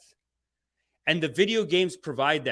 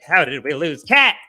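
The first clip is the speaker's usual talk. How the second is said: mock conservative; 9 dB louder than usual. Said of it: "They go like".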